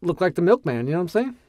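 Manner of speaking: ordinary delivery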